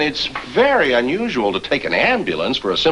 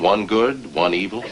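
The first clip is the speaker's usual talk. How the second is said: ordinary talk, nothing marked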